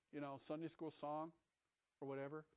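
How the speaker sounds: ordinary talk, nothing marked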